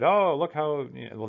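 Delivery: ordinary talk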